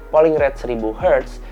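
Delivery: ordinary talk, nothing marked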